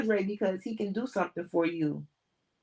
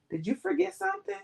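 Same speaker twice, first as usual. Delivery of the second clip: pointed question; 11 semitones higher, faster than usual. Acted. Narrator voices pointed question.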